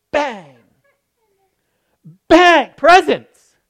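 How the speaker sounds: high, loud shouted announcement; shouted reveal. Acted. Preacher to congregation, shouting the big reveal. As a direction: burst out with the reveal like a bang.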